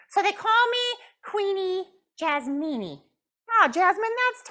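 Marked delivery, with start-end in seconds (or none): 0.1-3.0 high, slow, louder pouty puppet; pouting puppet. Acted. Puppet Jasmine tells the counselor she is mad, pouting high. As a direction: stretch out the sulk.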